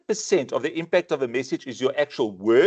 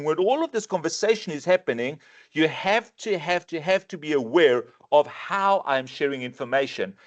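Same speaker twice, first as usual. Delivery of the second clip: ordinary delivery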